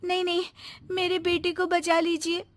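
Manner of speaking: desperate plea. Acted. Frightened mother begs and accuses her sister-in-law, pitched high.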